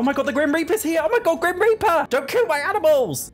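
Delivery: high, loud panicked pleading; panicked shriek. Real, not acted. Gamer pleading with the Grim Reaper, panicked high shriek. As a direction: beg the Reaper to spare your pets, in alarm.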